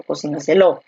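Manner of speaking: Cocináselo stressed on the final LO; stress on last syllable. Shown as a demonstration. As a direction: land on LO at the end of Cocináselo. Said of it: "¿Dónde está la fuerza de voz".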